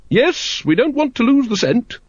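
very high, keen lady's voice; eager lady. A narrator's reading.

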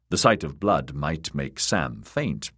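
usual speaking voice

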